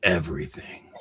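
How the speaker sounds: low, hushed whispered answer; dry whispered punchline. Real, not acted. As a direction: breathe the word like a secret. Joking answer to movie cost.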